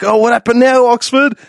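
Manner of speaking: high, loud taunting jeer at a passed-out drinker; mocking half scream. Drunk storyteller taunting the knocked-out Englishman in a mocking half scream. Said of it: "half screaming at him".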